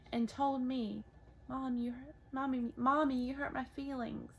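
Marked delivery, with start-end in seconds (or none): none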